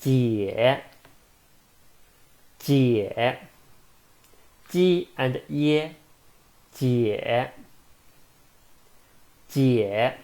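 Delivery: slow modelled 解 falling then rising; third tone. Shown as a demonstration. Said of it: "third tone".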